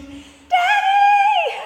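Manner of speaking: high, loud, drawn-out imitation of a thrilled toddler; sky-high mock little-girl shriek; child's squeal. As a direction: squeal it out like a three-year-old spotting a parent. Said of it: "at the sound of her squeal".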